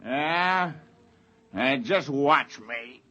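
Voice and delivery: low, slow dare; defiant taunt. Acted. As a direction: goad him on. Biggs taunts Spender defiantly.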